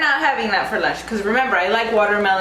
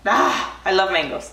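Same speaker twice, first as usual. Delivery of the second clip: ordinary talk, nothing marked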